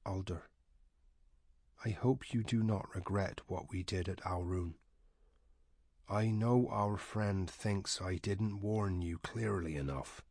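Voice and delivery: very quiet. A narrator's reading. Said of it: "his voice even quieter than usual".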